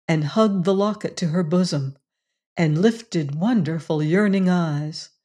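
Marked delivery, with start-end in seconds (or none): none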